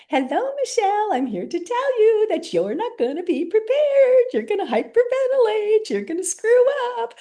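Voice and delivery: gleefully taunting like a cartoon gremlin; silly saboteur voice. Petty Betty mocking Michelle before her presentation in a shrieky silly voice. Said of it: "she's like".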